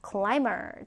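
usual speaking voice

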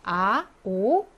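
아 and 오 drawn out slowly, one by one; vowels 아, 오 modelled slowly; vowel demo. Shown as a demonstration. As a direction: sound out 아 and then 오 very slowly. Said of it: "아 발음이 나죠".

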